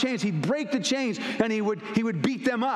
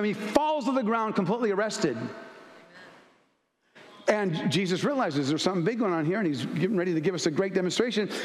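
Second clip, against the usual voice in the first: usual speaking voice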